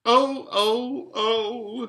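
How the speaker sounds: high, loud wailing moans; sobbing. A narrator's reading. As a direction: wail as a sobbing faun. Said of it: "sobbed Mister Tumnus".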